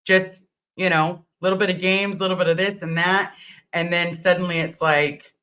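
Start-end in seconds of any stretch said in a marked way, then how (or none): none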